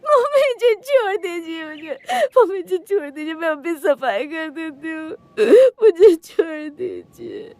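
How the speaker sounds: high, loud, tearful begging; crying out in pain. Acted. Daughter-in-law begs her mother-in-law for mercy, crying out in pain. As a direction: wail and plead for mercy. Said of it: "दर्द में चिल्लाते हुए अपनी सास से रहम की भीख मांग रही थी".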